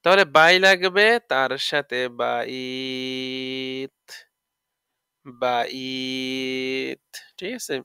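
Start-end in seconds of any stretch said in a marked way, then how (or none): 2.2-4.0 বাইত with a very long held glide before the final t; two-alif madd lin. Shown as a demonstration. Teacher demonstrating madd lin, glide held very long. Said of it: "তাকে দুই আলিফ মাদে লিন কয়". 5.3-7.0 বাইত with a very long held glide before the final t; two-alif madd lin. Shown as a demonstration. Teacher demonstrating madd lin, glide held very long. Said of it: "তাকে দুই আলিফ মাদে লিন কয়".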